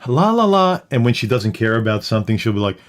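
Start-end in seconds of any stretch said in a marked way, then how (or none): 0.1-0.8 high, bright sing-song voice. Retold or imitated. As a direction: chirp it high and light. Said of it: "She'll be talking like this".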